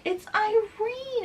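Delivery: high, drawn-out princess voice; excited announcement. Retold or imitated. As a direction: announce yourself high and slowly to a friend. Princess reveals who she is.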